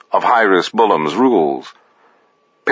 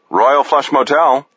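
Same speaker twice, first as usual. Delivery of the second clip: raised, sing-song front desk greeting; higher phone-voice clerk; motel desk clerk; 6 semitones higher than usual. A narrator's reading.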